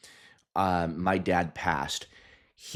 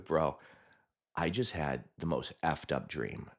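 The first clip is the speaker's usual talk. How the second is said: hushed shaken telling; 3 semitones lower, 7 dB quieter than usual. Retold or imitated. Telling husband dream, quietly.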